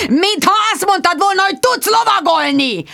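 very high, loud exasperated yell; angry yell. Acted. Producer shouts at Bridget who cannot ride, exasperated. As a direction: blast her for lying.

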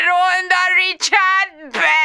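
high, slow, drawn-out yell; slow bitter shout. Acted. Jude wailing about Richard, drawn out. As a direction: cry out his name. Jude's outburst.

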